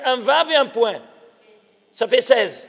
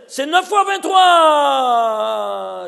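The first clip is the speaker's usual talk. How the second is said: slow, loud, emphatic shout of the numbers; booming slow declaration; excited proclaiming; 6 dB louder, much slower than usual. Real, not acted. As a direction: hammer out each word slowly like a revelation. Teacher stresses a number revelation.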